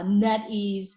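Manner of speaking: ordinary talk, nothing marked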